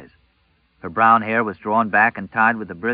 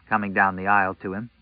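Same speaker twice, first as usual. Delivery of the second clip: plain reading voice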